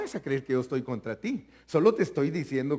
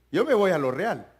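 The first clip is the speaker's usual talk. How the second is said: ordinary talk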